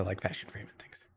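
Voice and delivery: soft whispered aside. Real, not acted. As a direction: drop to a whisper. Aside about other streamer.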